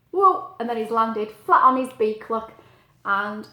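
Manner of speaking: usual speaking voice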